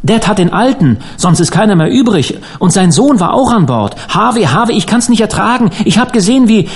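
high-pitched, overwrought outpouring; hysterical. A narrator's reading. Dan tells Harvey of the wreck he witnessed, hysterical. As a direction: pour it out in panic, pitch climbing to breaking off. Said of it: "sagte Dan hysterisch".

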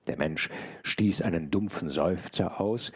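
ordinary delivery, nothing marked

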